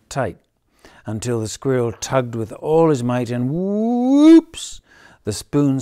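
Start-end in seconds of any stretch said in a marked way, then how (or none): 3.5-4.8 high loud whoops. A narrator's reading. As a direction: squeal it in surprise. Spoon flies.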